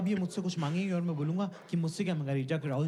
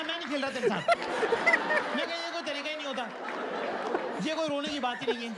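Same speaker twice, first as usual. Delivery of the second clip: indignant protest; 10 semitones higher than usual. Retold or imitated. Husband pleading with his crying wife, high and exasperated. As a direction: protest it's unfair, voice way up.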